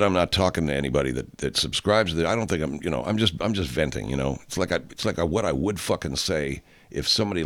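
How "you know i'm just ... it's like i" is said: normal talking voice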